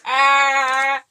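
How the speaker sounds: normal talking voice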